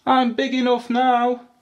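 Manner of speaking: high, loud little voice; baby elephant. A narrator's reading. Baby elephant answering mum where she'll go, high and eager. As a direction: announce it like an eager youngster. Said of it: "said the baby elephant".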